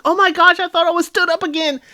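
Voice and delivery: high-pitched imitation of startled relief; relieved shock. Megan after Mike hid silently in the pod, startled relief. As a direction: squeal it lightly, imitating someone just pranked. Megan reacts to Mike's prank.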